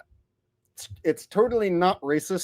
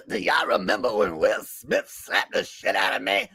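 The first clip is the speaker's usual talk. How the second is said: comic impression, low setup then raised punchline; Chris Rock; 3 semitones lower than usual. Comic reminding a crowd of the slap, mock Chris Rock voice. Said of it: "Haven't done Chris Rock in a while".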